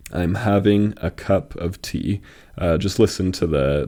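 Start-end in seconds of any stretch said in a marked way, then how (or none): 0.1-2.2 "I'm having a cup of tea" slowly, syllable by syllable, landing on "tea"; stress on tea. Shown as a demonstration. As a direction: spell out the rhythm slowly, weight on the final "tea". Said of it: "the emphasis of the syllables".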